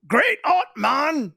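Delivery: put-on big, loud, high drunken proclamation; loud proclaim. Drunk stranger loudly praising the hat, loud. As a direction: boom it out like a happy drunk. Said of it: "loudly proclaims".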